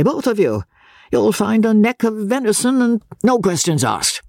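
put-on high, blustering old merchant; old Osborne offering his hand, high and blustering; pompous old father. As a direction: bluster like a proud rich father. Voices old Osborne at length.

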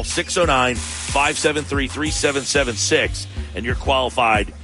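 ordinary talk

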